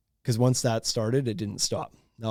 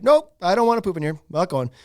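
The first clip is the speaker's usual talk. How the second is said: high, louder stubborn kid imitation; refusing child; 6 semitones higher, 4 dB louder than usual. Child refusing public toilet to parent, high stubborn kid voice. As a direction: dig in like a balking toddler. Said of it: "Come on, Kendall".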